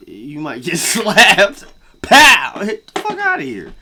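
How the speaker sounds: hammed-up yell at the winner; mock threat yell. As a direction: shout the slap threat.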